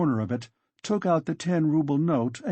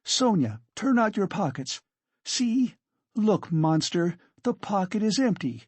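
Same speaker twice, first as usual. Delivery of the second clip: high, agitated command and proof; indignant urgency; 7 semitones higher than usual. Acted. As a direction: urge her on, high and vindicated. Katerina triumphantly shows empty pocket.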